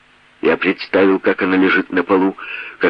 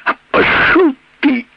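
furious outburst; 4 dB louder than usual. Acted. Woman lashing out at attacker.

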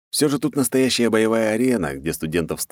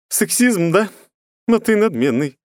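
high, mocking smirk; 12 semitones higher, 4 dB louder than usual. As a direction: sneer at his arrogance. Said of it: "ухмыльнулась она". (с ухмылкой)

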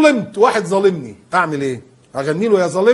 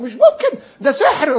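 much higher, disbelieving exclaim; astonished; 9 semitones higher, 4 dB louder, slower than usual. Retold or imitated. Coworker amazed the lost earring turned up, shocked exclamation. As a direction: gasp out disbelief. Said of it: "والله العظيم".

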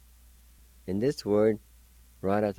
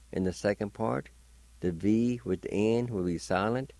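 ordinary talk

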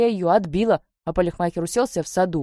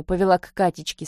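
plain reading voice

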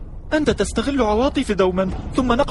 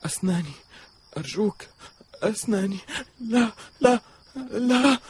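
sleep-talking whimper; 6 dB quieter than usual. Acted. Boy whimpers in his sleep.

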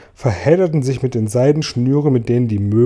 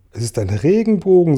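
higher cry of enthusiasm; delighted; 7 semitones higher, 4 dB louder than usual. A narrator's reading. Travellers all recognise the rainbow at once, delighted. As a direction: exclaim it, forgetting to whisper. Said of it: "Vor lauter Begeisterung".

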